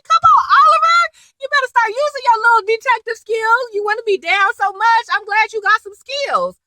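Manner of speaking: exasperated cheering-on. Retold or imitated. Viewer egging on Oliver the detective, exasperated and high.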